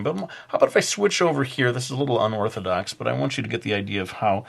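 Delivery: normal talking voice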